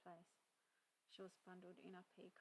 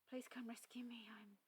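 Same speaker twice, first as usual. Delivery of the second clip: high, loud plea breaking into a whispered sob; bursting into tears; 4 semitones higher, 7 dB louder than usual. A narrator's reading. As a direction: she cracks and starts to cry mid-word. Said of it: "she burst into tears".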